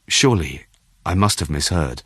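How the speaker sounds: usual speaking voice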